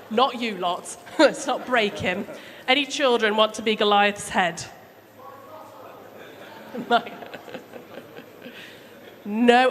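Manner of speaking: plain reading voice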